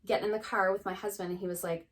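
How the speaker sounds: normal talking voice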